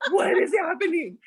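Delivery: high-pitched mock panic through laughter; squeaky, hammed-up disbelief; bewildered panic. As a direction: retell baffled alarm, half laughing.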